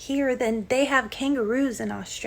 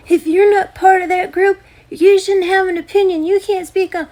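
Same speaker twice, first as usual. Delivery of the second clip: louder, higher sneering parody of critics; mocking quote; 7 semitones higher, 8 dB louder than usual. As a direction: throw their words back in a mocking, raised voice. Mocking critics' line during rant.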